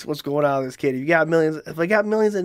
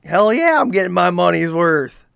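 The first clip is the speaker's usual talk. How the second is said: ordinary talk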